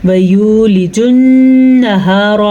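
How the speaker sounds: slow chanted وَيُولِجُ النَّهَارَ with ghunna; Quranic recitation. Shown as a demonstration. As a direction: recite slowly in tilawat style. Recites verse in lesson.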